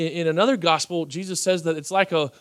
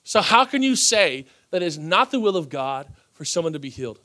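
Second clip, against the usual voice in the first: usual speaking voice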